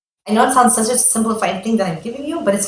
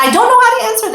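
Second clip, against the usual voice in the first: exasperated rush; 13 semitones higher, 4 dB louder, much faster than usual. Real, not acted. Overwhelmed by the question.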